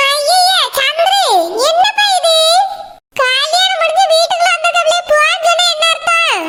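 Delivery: a shocked grandmother scolding gently; old grandmother voice. Acted.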